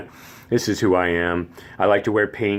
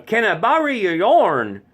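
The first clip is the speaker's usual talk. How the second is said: put-on mountain Tennessee accent; 12 semitones higher than usual. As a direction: ask a favour in a thick East Tennessee accent. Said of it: "born and bred Tennessean from the mountains".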